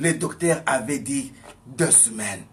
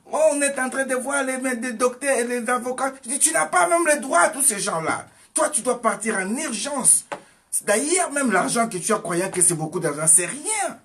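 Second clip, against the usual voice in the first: much higher and louder, pressing urgency; urgent preaching; 11 semitones higher, 6 dB louder than usual. Acted. Preacher urging his sick cousin to flee, urgent preaching. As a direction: hammer the warning home.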